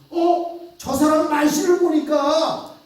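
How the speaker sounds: high and loud, drawn out at the end; bystander recognizing him. Acted. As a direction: point out his accent in triumph. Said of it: "마지막 또 사람들이 오더니".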